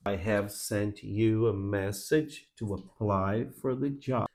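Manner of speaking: slow, soft "I have sent you a message", every word in full; slow unreduced reading. Shown as a demonstration. Demo sentence before reduction is shown.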